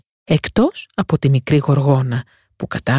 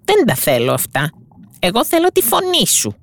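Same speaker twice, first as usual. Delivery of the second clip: much higher witch voice, craving the voice; a cunning witch naming her true price; greedy sea witch; 9 semitones higher than usual. Acted. Witch demands the mermaid's voice, greedy.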